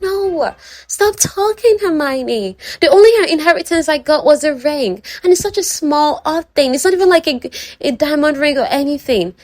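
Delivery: high, hurried protest, pitched well up; a mortified girl cutting her friend off, high and urgent; flustered Charlotte. Retold or imitated. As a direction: hush her friend in embarrassment. Embarrassed Charlotte cuts in.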